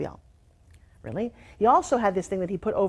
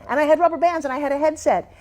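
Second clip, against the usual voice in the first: higher, lisping speech through braces; 6 semitones higher, 5 dB louder than usual. Shown as a demonstration. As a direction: talk like a kid with a mouth full of braces. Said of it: "So for years I talked like this".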